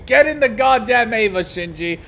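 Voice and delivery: hammed-up order to get in the robot; mock-stern shout; mock command. Quoting anime commander meme.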